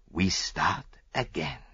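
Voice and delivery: put-on slow, accented satisfaction; beaming. A narrator's reading. Detective resolves to restart the case, beaming and deliberate. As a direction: say it slowly, pleased.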